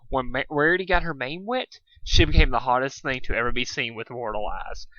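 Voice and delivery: ordinary delivery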